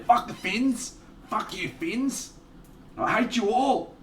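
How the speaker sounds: joking fake rage at a rival team; mock hatred. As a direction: pretend to hate the Finns, tongue in cheek. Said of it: "I don't really, mate".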